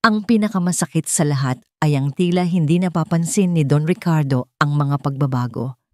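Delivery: usual speaking voice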